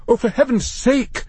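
high-pitched exasperated snap; snapping. A narrator's reading. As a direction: snap in sheer impatience. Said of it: "snapped Matthew".

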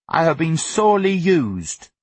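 put-on high, grandiose boyish lament; grand. A narrator's reading. Son copying his brother's phrase, grandly. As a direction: declare it with lofty self-pity. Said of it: "he added grandly".

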